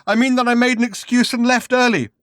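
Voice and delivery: high, loud blunt no; drugged man, loud. Acted. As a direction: bark it back. Drugged man's held voice.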